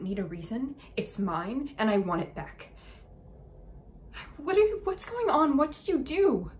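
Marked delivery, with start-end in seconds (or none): 4.4-6.5 high, loud and shocked; alarmed. Acted. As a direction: burst out in alarm. Sudden alarm at Amy.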